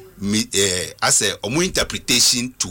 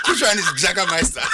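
laughing; 8 semitones higher than usual. Real, not acted. Laughing at the Jagermeister mix-up.

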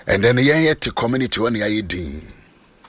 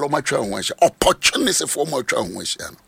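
ordinary talk, nothing marked

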